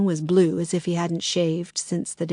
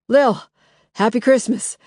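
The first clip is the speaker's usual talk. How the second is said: bright, loud, higher holiday hello; cheery greeting; 5 semitones higher, 7 dB louder than usual. A narrator's reading. Benny bursting into the kitchen, loud cheery greeting. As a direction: burst in with a happy shout.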